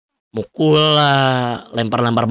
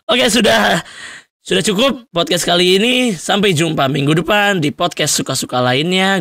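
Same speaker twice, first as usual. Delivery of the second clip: upbeat sign-off; 7 semitones higher than usual. Real, not acted. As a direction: wave the listeners goodbye.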